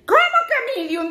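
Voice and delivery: mock outraged question; retelling a husband offended at his shirt size, hammed-up indignation; Jay indignant. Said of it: "ese hombre estaba de enojado".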